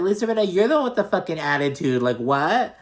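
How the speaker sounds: high, loud incredulous retort; indignant outburst. Pretend. Podcast host talking back to reality star about attitude, indignant. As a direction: fire back in disbelief, pitch jumping up.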